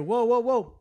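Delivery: high, loud triple warning; retold alarm. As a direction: cry out as if seeing a crash coming. Retold alarm just before the hit.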